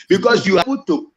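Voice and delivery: normal talking voice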